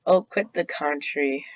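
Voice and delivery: ordinary delivery, nothing marked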